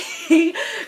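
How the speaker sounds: bright short giggle; real giggle; high laugh. Real, not acted. Laughs about tea.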